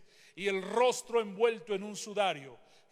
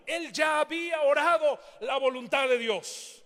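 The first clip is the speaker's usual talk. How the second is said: loud, higher, driving sermon voice; full-force preaching; 5 semitones higher, 6 dB louder than usual. Acted. Preacher exhorting the congregation, full-force preaching. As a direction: preach it with fire.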